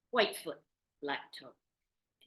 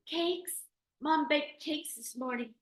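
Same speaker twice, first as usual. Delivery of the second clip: put-on eager kids; 10 semitones higher, slower than usual. A narrator's reading. Kids pleading.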